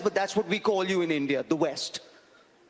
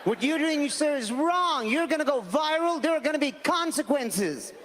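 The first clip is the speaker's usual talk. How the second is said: higher, preachy scolding voice; self-righteous American; 6 semitones higher than usual. Retold or imitated. American filming a hate crime scolds the man, higher and preachy. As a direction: lecture a stranger like a filming vigilante.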